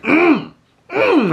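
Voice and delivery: squealed hum of adoration; high-pitched, delighted "mm, mm"; high swooning "mm". Retold or imitated. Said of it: "I was like".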